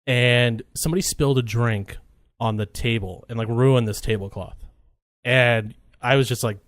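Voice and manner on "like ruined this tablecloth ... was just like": ordinary delivery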